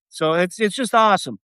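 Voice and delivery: usual speaking voice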